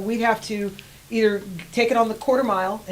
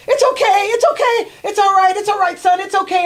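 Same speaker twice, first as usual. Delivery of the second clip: put-on high, loud soothing voice of an old farmer; calm the fallen rider down; farmer reassuring; 12 semitones higher, 7 dB louder than usual. Farmer reassuring the injured rider, high and soothing. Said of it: "And then I hear".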